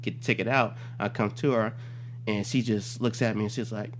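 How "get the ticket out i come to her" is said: normal talking voice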